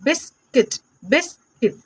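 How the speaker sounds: बिस्किट split as "bis... kit"; two-piece phonics reading of बिस्किट; sounding out. Shown as a demonstration. Teacher sounds out word in parts. (बिस्किट को "बिस्-किट" तोड़कर बोलें)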